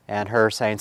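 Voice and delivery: plain reading voice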